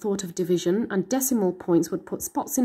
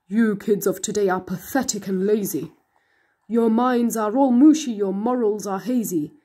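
a scheming monster voice selling a deal; sly monster; 2 semitones lower than usual. A narrator's reading. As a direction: tempt the kid like a smooth-talking monster. Monster scolds the kids.

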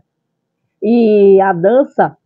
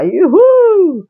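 excited; 8 semitones higher, 3 dB louder than usual. Real, not acted. Cheerful exclamation.

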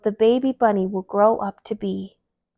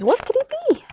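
wondering. Acted. Wondering question.

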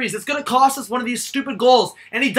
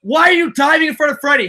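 raging gamer; 4 semitones higher, 5 dB louder than usual. Real, not acted. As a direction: yell at the screen in fury. Fan raging at player.

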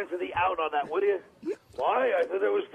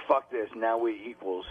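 low, slow mock-cool confiding; cocky peer; 7 semitones lower, slower than usual. Comic mimics Norton leaning over to Jerry, mock-cocky. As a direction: imitate someone smugly acting like an equal. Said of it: "leaning over to Jerry like".

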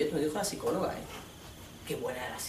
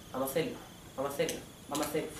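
quiet, fast nagging repetition; rushed pleading mumble; 5 semitones lower, 11 dB quieter, much faster than usual. Retold or imitated. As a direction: mumble it over and over, quickly. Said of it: "Cariño, vamos a hacerlo". (repetición rápida y baja del novio insistente)

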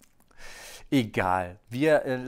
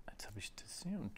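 whisper; 15 dB quieter than usual. Real, not acted. Muttering to himself while placing cards.

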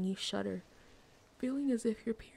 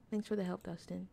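ordinary talk, nothing marked

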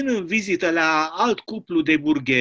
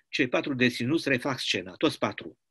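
usual speaking voice

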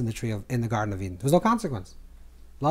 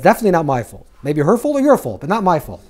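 raised, louder mock protest of innocence; defensive blame-shifting; 3 semitones higher, 8 dB louder than usual. Adam blames his wife and God, acted defensive protest. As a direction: push the blame away, louder and higher.

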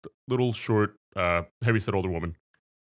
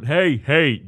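retold yelling; 6 semitones higher, 8 dB louder than usual. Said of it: "yelling into the".

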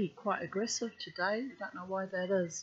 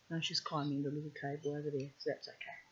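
quiet aside; 4 semitones lower, 8 dB quieter, much faster than usual. Real, not acted. Muttered aside while reading.

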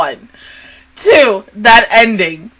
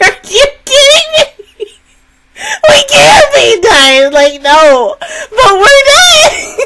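high, loud half-laughing protest; disbelieving wail; 11 semitones higher, 4 dB louder than usual. Retold or imitated. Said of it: "you're just like".

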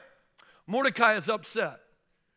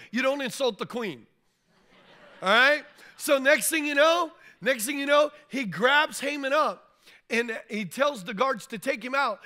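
loud, higher, driving sermon climax; preaching at full force; 3 semitones higher, 5 dB louder than usual. Acted. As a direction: build the story's peak for the congregation.

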